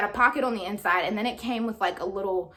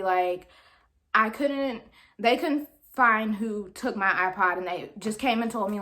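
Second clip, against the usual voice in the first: ordinary delivery, nothing marked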